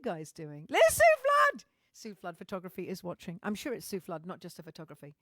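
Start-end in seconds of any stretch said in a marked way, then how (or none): 0.7-1.6 much higher and louder, delighted; excited greeting. Real, not acted. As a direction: call out her name with joy. Spots a friend watching the stream.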